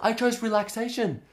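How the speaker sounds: high, incredulous inner complaint; alarmed protest. Retold or imitated. Acted alarm during painful massage.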